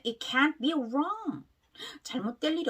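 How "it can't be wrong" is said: "can't be" run together slowly with a faint T; linked pronunciation. Shown as a demonstration. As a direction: model "it can't be wrong" as one connected phrase. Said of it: "연결되면". ("can't be"를 연결해서 천천히 발음)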